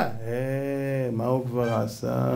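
quiet, lingering repeat of the scoff. Acted. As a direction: let it sink after a long "eh". Repeats the dismissal low, drawn out.

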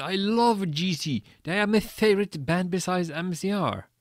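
high, excited fangirl gushing; hammed-up squealing enthusiasm; screamed excitement. A narrator's reading. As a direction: gush loudly about a favourite band. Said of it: "I screamed".

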